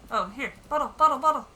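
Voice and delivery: loud, high, quick-fire repetition; excited spotting. Real, not acted. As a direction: call out your find with glee. Spots bottle in game.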